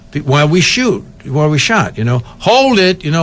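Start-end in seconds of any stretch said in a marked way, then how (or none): 2.4-3.0 mild high-pitched command; calling out. Retold or imitated. Crew tells cab drivers to wait, mild call. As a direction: call it out higher, as an order.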